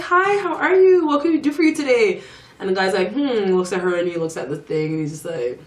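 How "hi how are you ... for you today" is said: high, sing-song customer greeting; chirpy receptionist greeting. Retold or imitated. Spa girls greeting a man who walks in, chirpy greeting. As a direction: mimic an eager hostess, pitched way up.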